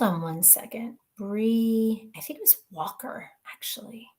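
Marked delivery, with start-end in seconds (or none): 2.2-4.1 hushed, thinking aloud; soft muttered recall; whispered. Real, not acted. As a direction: whisper while searching. Whispering while looking it up.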